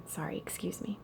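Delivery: barely voiced, very soft sorry; whispered apology. Real, not acted. Teacher apologising to the class for misreading, whispered. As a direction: drop to a whisper to excuse the slip.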